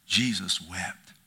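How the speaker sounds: low, slow and soft, almost breaking; hushed. Acted. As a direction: let it fall quietly and slowly. Preacher lingers on Jesus weeping.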